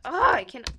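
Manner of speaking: high, loud burst of frustration; sudden loud high cry of defeat; exasperated outburst. Real, not acted. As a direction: blurt it out, fed up with the keyboard. Can't type, exasperated.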